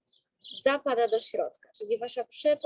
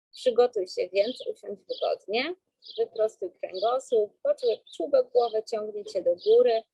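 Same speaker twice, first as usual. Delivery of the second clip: slow, calm breathing-coach voice; guided breathing. Acted.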